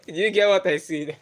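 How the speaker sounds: ordinary talk, nothing marked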